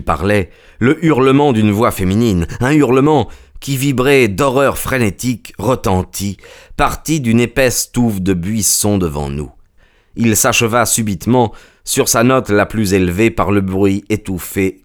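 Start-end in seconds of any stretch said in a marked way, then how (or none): none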